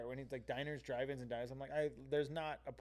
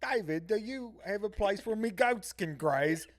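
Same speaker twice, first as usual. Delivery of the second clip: slow, higher, louder hammed-up British; mock British accent; 6 semitones higher, 6 dB louder than usual. Gordon asking David about goats, mock British accent. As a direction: play up a rustic British farmer. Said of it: "one of the stories was like".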